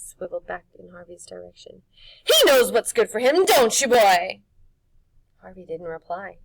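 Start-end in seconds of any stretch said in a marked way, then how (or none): 2.2-4.4 loud, higher crone taunt; witch voice. Acted. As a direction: tease the boy, sly.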